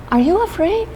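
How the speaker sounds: high playful ask; teasing question. Acted. Teacher playfully asking children if they are scared. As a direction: tease the kids about being scared.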